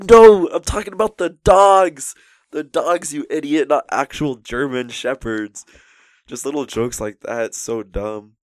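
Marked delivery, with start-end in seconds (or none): 0.1-5.6 loud, high mock outburst; hammed-up comic exasperation, easing slightly toward the end; exasperated yelling. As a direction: shout a drawn-out correction at an idiot underling. Acted outburst at idiot underling.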